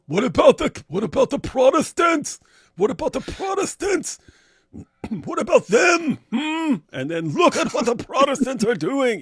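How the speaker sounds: high-pitched, loud mocking wail; mock whataboutism. Host parodies a dodged question about Orthodoxy, mock whataboutism. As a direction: wail it as a cartoonish objection.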